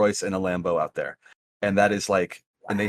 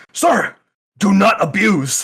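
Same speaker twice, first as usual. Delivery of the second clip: a hint of a stern officer, high and loud; stern lady; 11 semitones higher, 7 dB louder, slower than usual. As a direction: scold him like a strict official.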